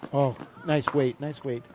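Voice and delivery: usual speaking voice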